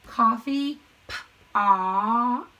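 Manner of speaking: Coffee pot drawn out very slowly, syllable by syllable; very slow sounding out. Shown as a demonstration. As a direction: stretch Coffee pot out for young readers. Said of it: "sounding out the coded word".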